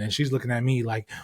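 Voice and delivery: normal talking voice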